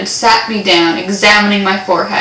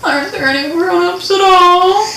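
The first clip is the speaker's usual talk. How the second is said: put-on higher, mildly sad boy asking; sadly; 10 semitones higher than usual. A narrator's reading. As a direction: ask it with a touch of sadness. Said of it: "the boy said sadly".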